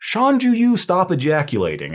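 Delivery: mock telling-off as a memory hook; mnemonic scolding. Shown as a demonstration. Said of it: "in a similar terms, I think".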